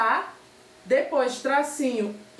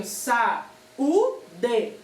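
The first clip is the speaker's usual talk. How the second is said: "sa, u, de" with a clear pause after each; syllable by syllable; much slower than usual. Shown as a demonstration. Teacher separating the word into syllables, "sa, u, de" spaced out. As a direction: give "sa, u, de" one isolated beat each. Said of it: "Quando eu vou separar essa palavra em sílabas".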